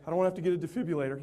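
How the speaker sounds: rattled off fast; fast tease. Retold or imitated. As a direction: toss it off quickly as a joke. Teasing aside.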